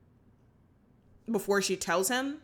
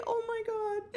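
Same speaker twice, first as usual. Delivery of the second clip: swooning; 12 semitones higher, 5 dB quieter than usual. Real, not acted. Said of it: "It was so sweet".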